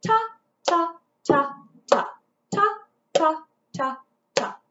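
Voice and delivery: each ta spoken on the beat; rhythm syllables. Shown as a demonstration. Teacher modelling quarter notes for the class. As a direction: chant ta evenly, one per beat. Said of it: "Same rhythm".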